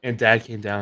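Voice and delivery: normal talking voice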